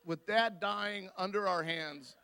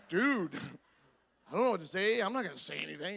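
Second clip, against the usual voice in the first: laughing dude; much faster than usual. Retold or imitated. Answering brother, laughing.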